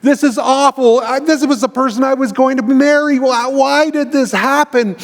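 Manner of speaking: high-pitched anguished plea to God; devastated prayer. Retold or imitated. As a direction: cry out in despair, re-enacting your prayer. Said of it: "I was crying. I was devastated".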